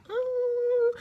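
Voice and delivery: high wailing "Ooh" with a sob; mock crying. Vlogger imitating crying trainees, high mock wail. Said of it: "they're all like".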